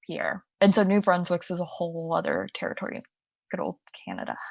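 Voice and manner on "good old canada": low, breathy whisper to yourself; wry whispered aside. Real, not acted. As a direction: toss it off under your breath. Wry aside about Canada.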